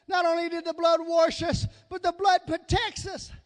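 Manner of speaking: punchy, measured sermon delivery; emphatic preaching. Real, not acted. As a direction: drive each point home to the congregation. Back to preaching.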